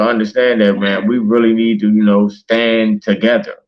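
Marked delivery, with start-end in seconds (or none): none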